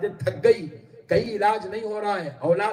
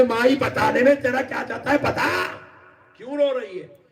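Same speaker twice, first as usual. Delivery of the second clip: put-on insistent child; 6 semitones higher than usual.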